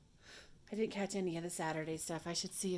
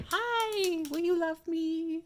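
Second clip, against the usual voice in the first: very high, loud, drawn-out eager dog voice; friendly dog; 11 semitones higher, 8 dB louder, much slower than usual. Acted. Other dog greeting a passerby, eager and needy. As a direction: squeal it like a dog begging for affection. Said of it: "The other dog is like".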